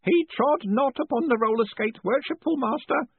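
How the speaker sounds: put-on high, eager old postman. A narrator's reading. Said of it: "Groat reported".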